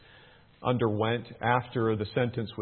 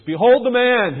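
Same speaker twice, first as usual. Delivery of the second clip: booming, much higher and louder; loud proclamation; 12 semitones higher, 11 dB louder than usual. Acted. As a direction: proclaim it to the whole crowd. Pilate presents Jesus to crowd.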